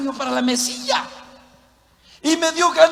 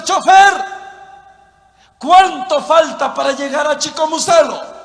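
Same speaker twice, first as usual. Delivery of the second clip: loud, higher passenger's call; 4 semitones higher, 6 dB louder than usual. Acted. Passenger asks the driver how far it is, calling out.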